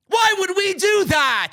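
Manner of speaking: high, slow mocking whine; mock booker. Mocking the WWE bookers in a high whine.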